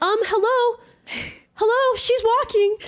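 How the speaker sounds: very high, loud, squealing hello; excited announcement. Retold or imitated. Chelsea announcing the miracle to family, high and thrilled. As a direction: shout it up the stairs, thrilled. Sister calling the family, voiced.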